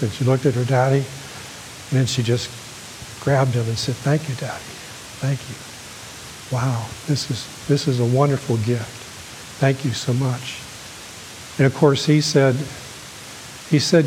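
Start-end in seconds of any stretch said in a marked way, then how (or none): 4.0-10.6 soft, half-whispered thank you, voice dropping away; a daughter quietly overwhelmed by her father's gift; hushed grateful thanks. Acted. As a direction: breathe the thanks out, overcome by the gift.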